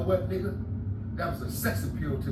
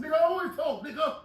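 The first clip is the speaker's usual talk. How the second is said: shouting back at the cellmate, higher; retold shout; 10 semitones higher than usual. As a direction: bark it back at him. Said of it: "I said".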